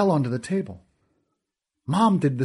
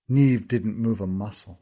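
ordinary delivery

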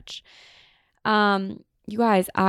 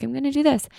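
excited new plan; 8 semitones higher, faster than usual. Retold or imitated. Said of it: "the next time I'm like".

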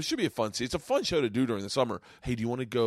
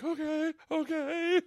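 much higher, small anxious okays; a scared rider calming himself, pitched way up; frightened okay; slower than usual. Retold or imitated. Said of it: "And I was like".

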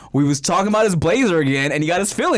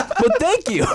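laughing; 12 semitones higher, slower than usual. Real, not acted. Laughing at the weird accent.

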